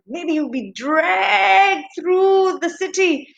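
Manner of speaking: storyteller building up the horror; dramatic dread. A narrator's reading. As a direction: drag the words out with alarm. Imagining a dreadful public punishment.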